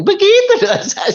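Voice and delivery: high, loud laughing exclamation; amused laughing. Real, not acted. Speaker confessing a vengeful prayer to the congregation, amused laughing. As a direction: laugh through the words, pitch leaping up.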